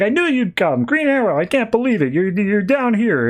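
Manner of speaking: high-pitched, gushing fanboy voice; lightly voiced eager young fan; starstruck fan. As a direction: gush at your hero like a thrilled kid. Fan [name] meets his hero.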